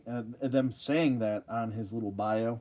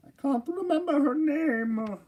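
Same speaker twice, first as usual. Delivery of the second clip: much higher, slow, strained comic whine; high drawn-out cartoon whine; silly high voice; 13 semitones higher than usual. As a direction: stretch it out in a high whiny voice. Repeats line in silly voice.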